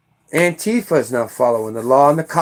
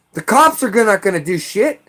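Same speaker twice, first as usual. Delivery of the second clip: ordinary delivery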